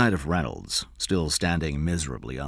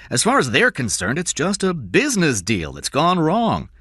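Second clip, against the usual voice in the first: loud, high, sharp insistence; 11 semitones higher, 7 dB louder than usual. A narrator's reading. King dismisses fears about the kidnappers, sharp. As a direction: insist sharply, brushing off the worry. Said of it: "King said sharply".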